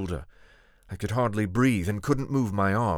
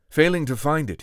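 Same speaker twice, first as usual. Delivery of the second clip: usual speaking voice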